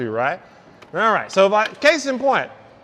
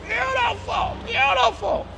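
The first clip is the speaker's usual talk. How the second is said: much higher, softer, drawn-out beautiful; high sighing praise; 14 semitones higher, 3 dB quieter, slower than usual. Real, not acted. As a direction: coo the word like admiring a car. Repeats beautiful, then high.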